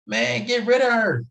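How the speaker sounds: high, loud and slow, insisting hard; emphatic urging. Real, not acted. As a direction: lean in and press the point home. Urging men to leave toxic partner.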